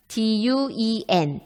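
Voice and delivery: 디유이엔 read slowly, letter by letter; spelled out letters. Shown as a demonstration. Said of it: "무엇이 맞나요".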